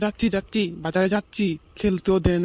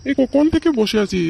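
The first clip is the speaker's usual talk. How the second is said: whiny complaint; 6 semitones higher, 4 dB louder than usual. Acted. Son whining for food.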